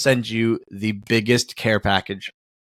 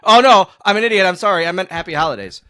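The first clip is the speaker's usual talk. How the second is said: high, louder flustered correction; flustered apology; 8 semitones higher, 5 dB louder than usual. Retold or imitated. Man to grocery clerk, imagined flustered apology. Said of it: "so I couldn't be like".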